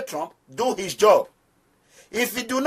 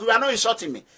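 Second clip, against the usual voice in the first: ordinary delivery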